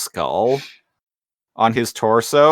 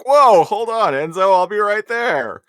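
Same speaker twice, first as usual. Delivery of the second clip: eager hero voice; 10 semitones higher than usual. Retold or imitated. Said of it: "he's like".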